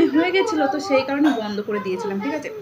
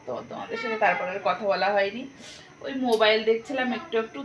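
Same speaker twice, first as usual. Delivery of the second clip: usual speaking voice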